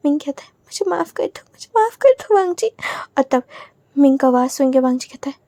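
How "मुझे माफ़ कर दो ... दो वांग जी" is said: high, tear-choked begging for forgiveness; guilty friend begs forgiveness, crying and pleading; tearful plea. Acted. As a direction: plead through tears, rising in pitch. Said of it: "उसकी आंखों से भी आंसू निकल जाते हैं".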